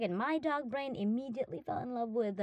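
ordinary delivery

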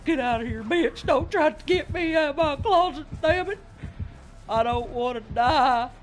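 panicked yelling. Real, not acted. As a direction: shout it off from the closet. Yelling at monster from closet.

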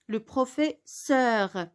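"Le professeur" very slowly, each syllable clear; dictation pace. Shown as a demonstration. Teacher dictating to pupils, very slowly. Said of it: "C'est une règle".

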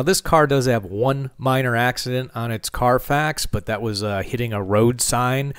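usual speaking voice

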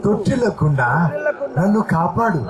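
intense pleading prayer, rising on each plea; fervent prayer. Acted. Preacher prays over the congregation, fervent pleading prayer. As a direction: pour it out to Jesus, pleading.

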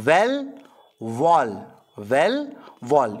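Well rising with short e, wall falling with aw; vowel contrast drill. Shown as a demonstration. Teacher drilling the pair Well and wall. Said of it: "अंतर समझे आप".

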